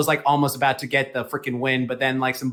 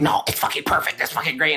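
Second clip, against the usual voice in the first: strained, voiceless imitation of a defensive fan; mocking fanboy protest; mock rabid fan. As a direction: push it out like a fanatic in denial. Imitates rabid fans defending the show.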